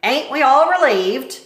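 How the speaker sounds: loud sarcastic cook. Acted. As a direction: sneer it loudly. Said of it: "said Cook".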